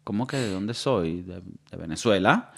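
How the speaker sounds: low, slow, soft answer; puzzled reply. Retold or imitated. Man asked his origin by a compatriot, quietly puzzled. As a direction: drop low and hesitate, taken aback.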